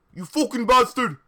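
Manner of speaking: loud furious shout; angry yell. Acted. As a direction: yell it at your rival. Acted yelling at rival.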